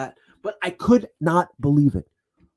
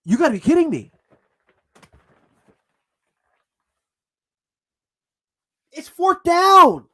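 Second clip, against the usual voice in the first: incredulous shout; 10 semitones higher, 7 dB louder than usual. Retold or imitated. To his father over the coach's call, incredulous shout. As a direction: yell it like you can't believe the call. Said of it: "I look at my father ... and I go".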